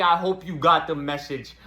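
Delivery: ordinary delivery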